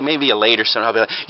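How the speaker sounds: ordinary talk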